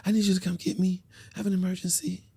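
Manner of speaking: high-pitched mock girlfriend voice; high voice asking a favour; imitating woman friend. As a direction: put on her high pleading voice.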